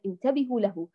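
ordinary talk, nothing marked

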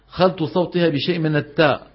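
ordinary talk, nothing marked

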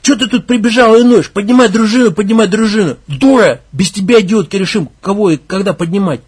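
loud, high angry shouting; enraged leader berating the girl; furious yelling. Acted. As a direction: tear into her. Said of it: "разъярился он".

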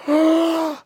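sharp, loud high gasp. Acted. Comic shock before the next scene, loud gasp.

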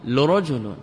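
لرجل begun with a "u", "lu-rajulun"; damma on lam. Shown as a demonstration. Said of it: "الضم".

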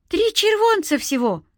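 put-on very high, gleeful child; overjoyed little seller; delighted boy. A narrator's reading. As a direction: pipe up happily like a kid. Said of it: "обрадовался Сенька".

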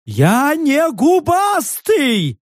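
high, slow indignant yell; high offended creature shout. A narrator's reading. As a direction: shout back, insulted beast.

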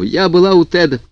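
tearful and rushed; crying on the phone. A narrator's reading. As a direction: sob it out over the phone. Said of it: "всхлипывала она в трубку".

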